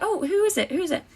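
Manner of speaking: high, quick-to-know nosy questioning; mock-innocent, voice lifted high; feigned eager curiosity. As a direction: ask like an innocent, curious passer-by. Retold nosy questions to police.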